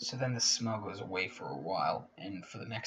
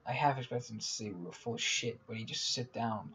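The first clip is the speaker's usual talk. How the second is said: ordinary delivery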